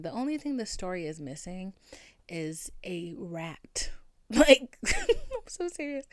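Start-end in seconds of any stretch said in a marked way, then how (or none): 3.9-6.0 high laughing burst. Real, not acted. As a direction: laugh through the words. Laughing mid-story.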